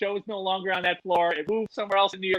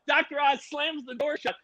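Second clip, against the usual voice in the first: ordinary delivery, nothing marked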